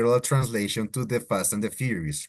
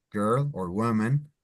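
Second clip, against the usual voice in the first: ordinary talk, nothing marked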